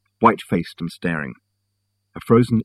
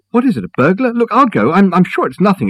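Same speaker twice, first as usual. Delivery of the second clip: put-on higher, anxious young man; Richard anxious; 10 semitones higher, 4 dB louder than usual. A narrator's reading. Richard blocking Reg at the door, higher and anxious. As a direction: rush to reassure while nervous.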